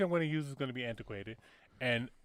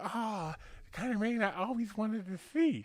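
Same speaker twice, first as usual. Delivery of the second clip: higher, put-on impeded speech; a dreamy little man with a speech impediment; 5 semitones higher, 4 dB quieter, slower than usual. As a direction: joke teller voicing the little man admiring the horse. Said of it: "he's got a fucking speech impediment".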